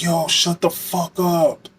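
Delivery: hostile inmate. Retold or imitated. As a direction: mimic the hostile inmate slowly and sneeringly. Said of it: "He was like".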